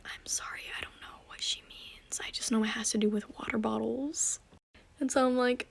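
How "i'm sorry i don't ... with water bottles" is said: whispered apology, almost silent; embarrassed whisper. Retold or imitated. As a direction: whisper it so the kid won't hear. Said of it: "her mom was looking at me like".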